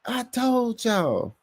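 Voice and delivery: very high, drawn-out catchphrase; Mad Mike. Retold or imitated. Host echoes the catchphrase as Mad Mike, high slow holler. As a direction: stretch it out in a mock high holler. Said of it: "in my Mad Mike voice".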